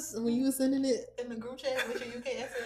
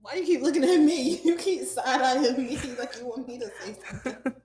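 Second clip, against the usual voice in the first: louder, amused challenge breaking into laughs; laughing protest; 3 semitones higher, 9 dB louder than usual. Real, not acted. Host to friend side-eyeing her, laughing accusation. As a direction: call the friend out while laughing.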